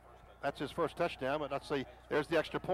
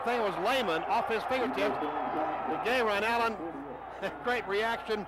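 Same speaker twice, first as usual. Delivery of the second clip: loud, higher amazed call; excited praise; 4 semitones higher, 3 dB louder than usual. Real, not acted. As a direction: marvel at the play out loud. Replay praise after interception.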